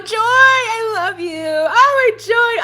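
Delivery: high, loud and delighted, stretched out; excited greeting. Real, not acted. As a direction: gush over a friend joining. Greeting a viewer named Joy.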